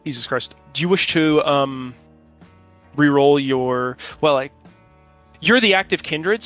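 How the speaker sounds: ordinary delivery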